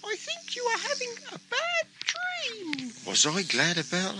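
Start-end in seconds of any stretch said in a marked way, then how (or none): 0.0-2.9 very high, softer reassurance; very high gentle voice. Acted. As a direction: soothe him from the dream. Simba reassures Geoffrey.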